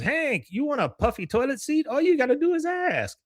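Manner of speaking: very high-pitched character impression; high, singled-out cartoon neighbour voice; Con. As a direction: needle the neighbour in a high mock voice. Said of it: "Con comes up and goes".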